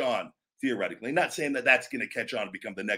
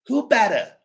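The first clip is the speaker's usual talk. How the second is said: higher, louder punch on who; confident catchphrase; 9 semitones higher, 6 dB louder than usual. Shown as a demonstration. Wrestler testing a catchphrase, confident. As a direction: snap it out with conviction. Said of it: "Which one's better?".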